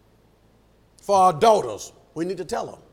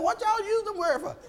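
very high, softened incredulous question; shocked teacher; 14 semitones higher than usual. Retold or imitated. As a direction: squeak out the teacher's mock dismay. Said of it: "I was shocked".

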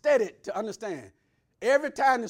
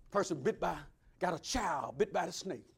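usual speaking voice

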